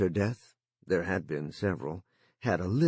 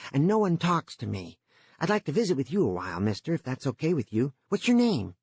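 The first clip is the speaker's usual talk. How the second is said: put-on eager, higher boyish voice; young boy voice; 6 semitones higher than usual. A narrator's reading. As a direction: play the lonely boy, keen to chat. Jory speaks through the line.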